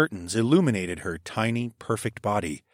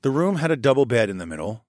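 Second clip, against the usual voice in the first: usual speaking voice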